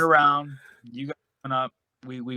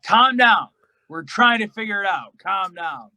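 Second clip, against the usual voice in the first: raised, insistent calming plea; retold urgent plea; 6 semitones higher, 7 dB louder than usual. Wrestler to raging colleague, urgent calming plea. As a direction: call out to settle someone.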